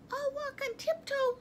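very high, thin mouse voice; little falsetto, eager to obey; Mouse, meek. A narrator's reading. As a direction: squeak a timid promise. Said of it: "said Mouse".

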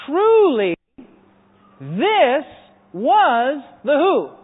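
TRULY and THIS loud, each word set apart; loud, drawn-out "truly, this was", with pauses between; emphatic proclamation. Acted. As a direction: ring out "Truly... this... was" slowly, one beat each. Builds the verse for congregation to complete.

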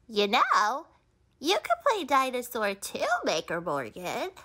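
normal talking voice

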